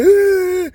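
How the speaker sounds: high, loud mock wail; mock crying wail. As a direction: let out a piercing cartoon cry. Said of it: "started crying".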